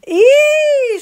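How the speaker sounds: piercingly high, loud "eek" squeal; startled high-pitched scream; shriek. Acted. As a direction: shriek as if doused with water. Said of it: "schrie Isabel auf".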